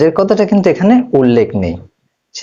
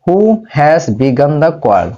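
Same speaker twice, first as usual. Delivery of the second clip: slow model sentence; much slower than usual. Shown as a demonstration. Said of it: "এটা কি?".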